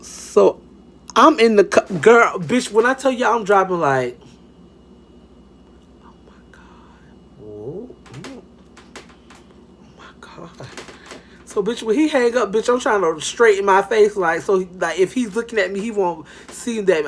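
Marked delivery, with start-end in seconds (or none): 6.0-10.7 barely audible, drawn-out whisper; hushed private shock, slow and whispered; scandalized whisper. Retold or imitated. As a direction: gasp it under your breath, very slowly. Secret reaction while driving.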